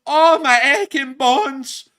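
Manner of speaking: slow, high, loud moan; hammed-up groan of aches and pains; mock complaining. Complaining to all the viewers about tiredness, theatrical whine. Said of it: "now we're both gonna start complaining".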